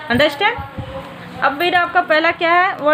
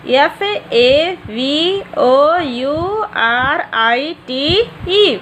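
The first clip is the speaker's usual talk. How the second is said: each letter drawn out slowly; slow spelling; much slower than usual. Shown as a demonstration. Teacher dictating a spelling to young students, slow and deliberate. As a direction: spell it out one letter at a time for the class. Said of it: "favourite".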